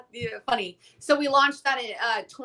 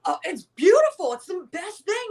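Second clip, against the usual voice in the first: father's voice; 7 semitones higher, 4 dB louder than usual. Retold or imitated. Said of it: "My father's like".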